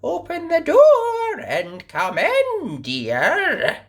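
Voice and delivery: high, slow fake granny sweetness; wolf as grandma. A narrator's reading. Wolf disguised as grandma inviting the girl in. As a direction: lure her in sweetly as granny. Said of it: "said the wolf".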